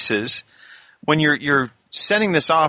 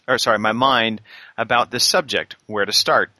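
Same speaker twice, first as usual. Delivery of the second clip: normal talking voice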